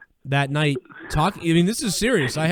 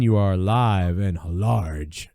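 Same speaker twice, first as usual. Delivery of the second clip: radio host greeting; 8 semitones lower, much slower than usual. Acted. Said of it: "radio host greeting caller".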